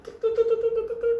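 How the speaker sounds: very high rapid-fire tuks; quick string of high ticking sounds; imitated tuk-tuk noise. Shown as a demonstration. Storyteller mimics a noise on turning the corner, high tuks.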